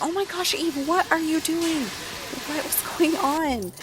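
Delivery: high, loud mock panic; high-pitched, alarmed disbelief; freaking out. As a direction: act out a panicked brother, voice way up. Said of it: "Here comes Evan freaking out".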